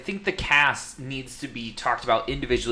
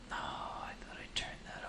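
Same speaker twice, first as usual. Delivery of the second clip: whispered aside; 22 dB quieter than usual. Real, not acted. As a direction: whisper it to yourself. Muttered aside about a device.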